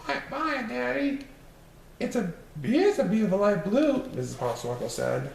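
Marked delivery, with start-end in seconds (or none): 0.1-1.3 put-on high little kids' voices; sing-song like small kids; children's goodbye. Children wave to daddy. 2.0-4.0 high, louder lady's voice; mother's voice. Acted. Missus Popsnuggle speaks.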